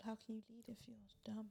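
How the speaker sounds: whispered, fast and faint; teasing whisper. Real, not acted. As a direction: slip the jab in under your breath. Teasing aside to the guys.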